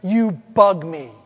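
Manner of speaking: loud, slow booming voice from the heavens; booming voice of God. Retold or imitated. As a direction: boom it down from the sky, slow and loud. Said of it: "this mighty booming voice from the heavens says".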